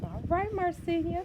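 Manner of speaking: high, excited cheer. Real, not acted. Dad cheering the girl in the story with a high whoop. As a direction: celebrate the home run.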